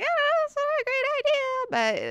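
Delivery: very high, put-on girlish voice; reluctant girl imitation. Girl balks at the hypnotist, high reluctant squeak. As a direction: squeak out a hesitant mock objection.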